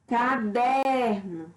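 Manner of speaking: "Caderno" slow, with DER stretched out; stress on middle syllable. Shown as a demonstration. Teacher giving a paroxytone example, stress on penultimate syllable. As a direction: lean long on the DER of "Caderno". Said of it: "penúltima sílaba".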